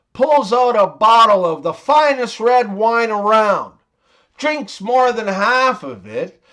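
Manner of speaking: ordinary talk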